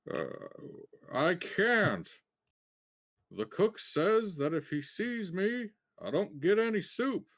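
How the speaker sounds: a hint of a gruff dwarf. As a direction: fake a gruff dwarf to fool the guard. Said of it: "try to make herself sound as, like a gruff dwarf".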